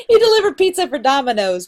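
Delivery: amused, laugh-filled delivery. Real, not acted. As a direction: burst out laughing, then tell it. Amused by student's inflated job title.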